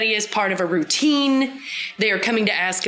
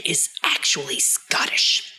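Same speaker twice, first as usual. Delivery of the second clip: whisper; 4 semitones lower than usual. Acted. Dramatic reveal.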